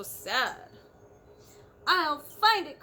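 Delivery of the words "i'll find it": loud, higher cry with "find" hit hard; determined cry. A narrator's reading. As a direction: vow it out loud. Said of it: "cried Horton".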